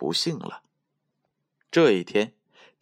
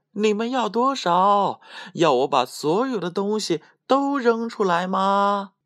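loud calling out; 12 semitones higher than usual. A narrator's reading. Tom loudly asking the thieves, high calling voice. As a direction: call out in a much higher character voice. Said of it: "继续大声叫道".

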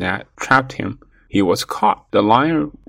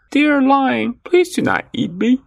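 high-pitched pleading mouse voice; begging little mouse; 13 semitones higher than usual. A narrator's reading. Mouse caught by a lion about to eat him, begging. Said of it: "kept talking and begging".

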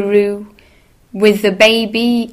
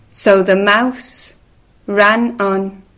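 plain reading voice

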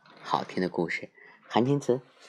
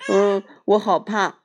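scared little bear; 11 semitones higher, 6 dB louder than usual. Acted.

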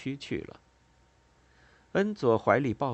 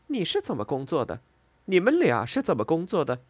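mildly irritated, higher-pitched retort; annoyed retort; 7 semitones higher, 4 dB louder than usual. A narrator's reading. Worker shaking off a friend's hand, annoyed retort. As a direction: throw the question back, rising in pitch and force. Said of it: "这种身体接触让他很烦".

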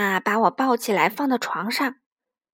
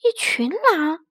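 slow, higher astonished question; 睁大眼睛的惊讶; 4 semitones higher, slower than usual. A narrator's reading. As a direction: draw it out in surprise. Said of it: "妈妈睁大眼睛说". (惊讶地拖长反问)

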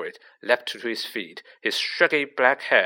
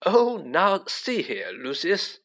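higher, slow flustered protest; lightly voiced worried official, objecting; a touch of alarm, drawn out; mildly alarmed; 7 semitones higher, slower than usual. A narrator's reading. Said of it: "said Fudge, looking alarmed".

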